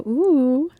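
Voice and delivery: loud, high ooh, imitating own reaction; re-enacted surprised ooh. Reader shows which card made her react, imitated ooh. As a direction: replay your surprise as a big ooh. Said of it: "the one that made me go".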